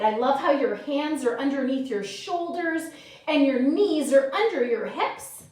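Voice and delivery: ordinary talk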